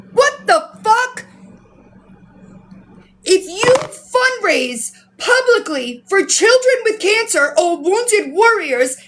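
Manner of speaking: high, emphatic indignation; outraged rant. Real, not acted. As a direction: hammer out each phrase in outrage. Angry rant about charity fraud.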